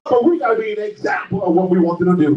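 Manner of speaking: high, driving sermon cry; fervent preacher with stretched words and gasped breaks; full-force preaching. Real, not acted. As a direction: preach it out in punched, rising phrases.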